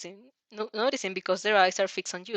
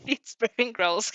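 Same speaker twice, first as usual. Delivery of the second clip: usual speaking voice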